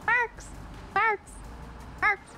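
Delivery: high, sing-song calling of the name. Real, not acted. Streamer calling out to Marcos in chat, high and teasing. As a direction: call him over and over, sweetly.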